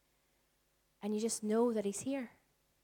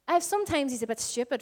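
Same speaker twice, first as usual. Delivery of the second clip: normal talking voice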